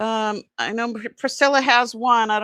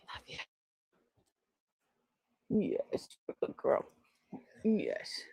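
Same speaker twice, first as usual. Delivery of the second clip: whisper; 11 dB quieter than usual. Real, not acted. Whispering to dog.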